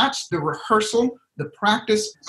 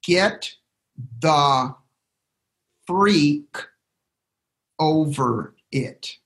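"Get", "the", "freak", "over it" each spaced out and slow, with "freak" falling; slow, emphatic; 2 semitones lower, much slower than usual. Shown as a demonstration. Coach drills the key phrase for listeners, slow and spaced. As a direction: lay down each word slowly like a rule. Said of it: "write this down".

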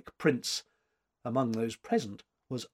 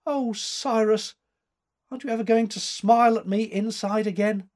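sobbing appeal; 8 semitones higher, 3 dB louder than usual. A narrator's reading. Bonny lassie pleading with Cyrus, a touch of sobbing. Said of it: "heard her sobbing appeal".

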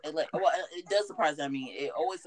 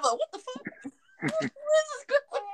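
high startled squeal; shocked; slower than usual. Retold or imitated. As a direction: shriek in laughing disbelief. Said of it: "I'm like".

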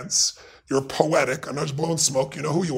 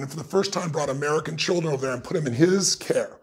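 ordinary talk, nothing marked

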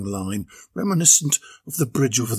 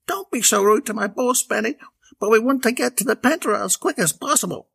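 higher, scolding sister; Bella; 10 semitones higher than usual. A narrator's reading. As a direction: tell him off then hurry him. Bella scolds her brother.

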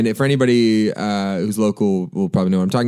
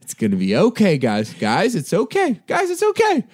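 calming; 11 semitones higher than usual. Retold or imitated. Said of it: "I just kept on saying".

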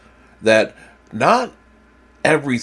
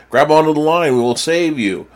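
raised, higher call over the rotor noise; 7 semitones higher, 4 dB louder than usual. Acted. Helicopter crew calling to the drowning man, raised call. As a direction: shout the rescue offer up high.

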